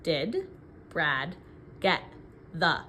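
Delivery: word by word with pauses; counting the words. Shown as a demonstration. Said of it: "let's count the words".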